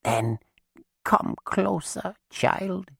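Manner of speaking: very high, slow croak; croaking dead witch. A narrator's reading. Chained witch lures Alice closer, croaking. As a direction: beckon the child close, croaking. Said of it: "Maggie croaked".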